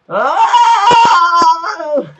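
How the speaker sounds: very high, very loud shrieking "Oh!" with a laugh in it; imitating Gary Neville's noise. Mocking the commentator, a screaming laughing "Oh". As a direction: shriek like a commentator who lost all words. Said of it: "so you just went".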